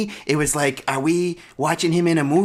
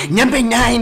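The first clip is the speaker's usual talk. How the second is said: high, loud announcement; worked up; 7 semitones higher, 7 dB louder than usual. Acted. Critic pressing on over co-host's objections, worked up. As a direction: plough on with the list, raised voice. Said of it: "I'm getting worked up".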